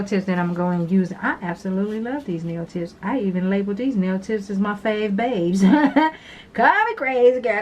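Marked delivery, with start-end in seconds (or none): 5.7-6.2 a burst of real laughter; short loud laugh. Real, not acted. To viewers after naming tips fave babes, loud laugh.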